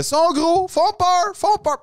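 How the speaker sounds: high, loud acted locker-room cry; slow, high-pitched mock shouting; rousing speech. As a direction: belt it out like a panicked pep talk. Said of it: "le monologue à la fin".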